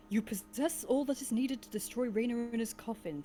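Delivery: usual speaking voice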